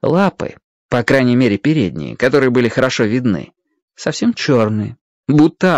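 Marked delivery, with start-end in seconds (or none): none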